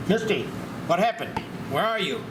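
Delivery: normal talking voice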